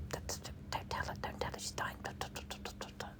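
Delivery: urgent, repetitive mock whispering; barely voiced, secretive bedside whisper; imitated whisper. Imitating visitors hiding news from the patient, whispering. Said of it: "Not sitting there whispering".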